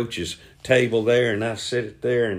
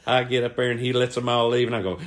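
usual speaking voice

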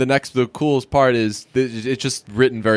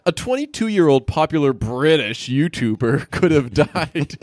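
slow, breaking into a laugh; dramatic headline read; 2 semitones higher than usual. Shown as a demonstration. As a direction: read it like breaking news and crack up. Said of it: "let's read the BuzzFeediness of it".

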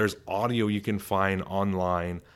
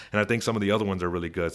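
ordinary talk

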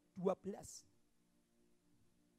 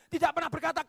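ordinary talk

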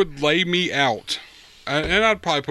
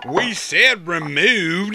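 slow, drawn-out attempt at an Alabama accent; weak Alabama accent; 2 semitones higher, much slower than usual. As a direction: try an Alabama drawl and half miss it. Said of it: "you can't do a really good Southern accent".

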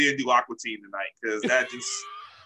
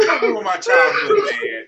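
high, loud wail of disbelief; anguished comic outcry; dismayed protest; 5 semitones higher, 7 dB louder than usual. Real, not acted. Mock dismay at the show's synopsis.

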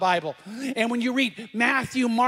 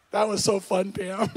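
chuckling through the words; real laugh at his own prank; amused teasing. Real, not acted. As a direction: tease her, breaking into a laugh. Teasing his wife about the prank.

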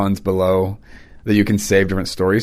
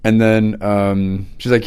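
ordinary delivery

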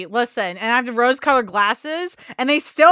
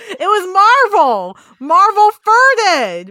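loud slow accusation; 8 semitones higher, 7 dB louder, slower than usual. Real, not acted. Blaming Marvel for fart.

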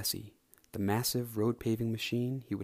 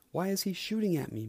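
much higher, frantic panicked cry; 8 semitones higher than usual. Acted. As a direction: blurt it out in a panic. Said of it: "McQueen panicked".

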